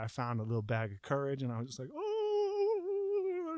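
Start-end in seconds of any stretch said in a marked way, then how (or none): 1.9-3.6 long, high "oh" of dread; frightened wail. Retold or imitated. Man who just found courage to stand, high frightened wail. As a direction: let out a high, drawn-out mock wail.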